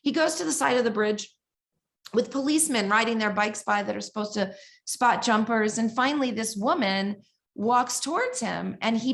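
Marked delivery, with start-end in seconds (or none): none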